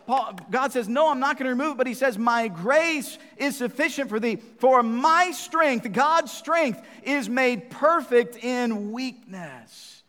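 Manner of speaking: loud, high, driving sermon climax; preaching at full force. Acted. Preacher thundering God's answer to Paul at his flock, full force. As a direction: hammer the point home to the congregation.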